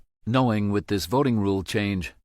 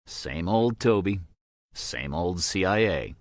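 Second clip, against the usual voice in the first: plain reading voice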